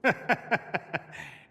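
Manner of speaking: high dry chuckle; wry chuckle. Acted. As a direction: chuckle at the understatement. Amused by remark about bad blood.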